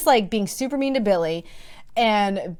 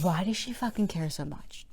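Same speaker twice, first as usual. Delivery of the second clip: exasperated whisper; whispered frustrated aside; 4 semitones lower, 11 dB quieter than usual. Real, not acted. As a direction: hiss it under your breath.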